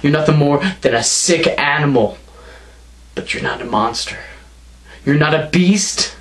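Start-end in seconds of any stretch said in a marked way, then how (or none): 3.1-4.2 mocking whisper. Belittling his rival.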